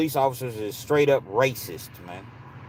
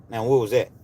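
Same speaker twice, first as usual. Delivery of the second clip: usual speaking voice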